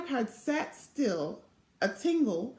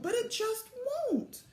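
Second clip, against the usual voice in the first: moaning; 11 semitones higher, 3 dB quieter than usual. A narrator's reading. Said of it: "Velma moaned".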